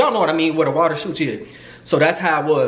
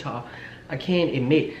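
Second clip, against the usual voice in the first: normal talking voice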